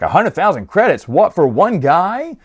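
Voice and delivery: mock astonishment at the offer; incredulous. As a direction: question it in disbelief, stretching the end. Acts out her reaction to the payment.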